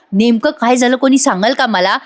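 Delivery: normal talking voice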